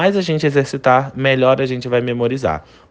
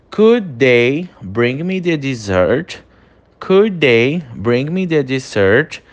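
slow, careful polite request; slow English model sentence; much slower than usual. Shown as a demonstration. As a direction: model it slowly. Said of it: "Penúltimo exemplo". (pedido educado em inglês, devagar)

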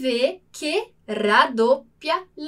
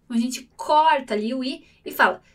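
usual speaking voice

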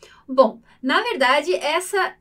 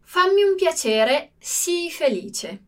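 slow model reading; 3 semitones higher, slower than usual. Shown as a demonstration. Teacher reading an example sentence to students, slow and deliberate. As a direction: read it out slowly for the class to copy. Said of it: "vediamo il nostro prossimo esempio".

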